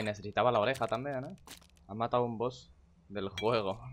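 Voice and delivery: ordinary talk, nothing marked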